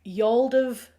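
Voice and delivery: "Y'all of" tried with a falling end; butchered attempt. Shown as a demonstration. A Yorkshire host nervously trying out a new phrase. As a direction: fumble through "Y'all of" as an outsider. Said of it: "I am going to completely butcher that now".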